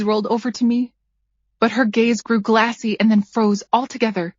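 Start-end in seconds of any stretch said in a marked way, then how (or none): none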